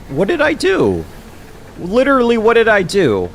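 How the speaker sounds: high, loud baffled yell; exasperated shout. Real, not acted. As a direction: yell it at the ceiling in disbelief. Baffled by TikTok penalty.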